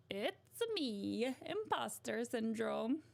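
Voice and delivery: bouncy video game plumber impression; sing-song catchphrase, stretched first words; cartoon plumber announcing himself; Mario. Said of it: "Mario's a good".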